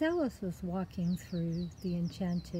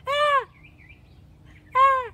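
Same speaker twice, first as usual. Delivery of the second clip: short, high, loud scream; startled scream; 13 dB louder than usual. Acted. Alice screams on looking up, startled. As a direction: shriek twice in fright. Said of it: "She looked up and went".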